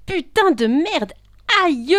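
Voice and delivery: furious, high, drawn-out yell; pained scream. Acted. Woman who just fell downstairs, screaming in pain. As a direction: howl the curse in pain.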